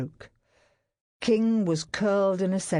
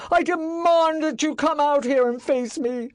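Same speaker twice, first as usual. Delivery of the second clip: high, loud demanding shout; furious shout; 11 semitones higher, 5 dB louder than usual. A narrator's reading. Master shouting at Barrett's house. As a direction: demand he come out. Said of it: "shout at the top of his lungs".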